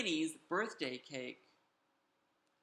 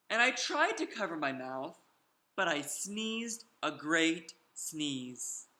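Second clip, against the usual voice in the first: ordinary delivery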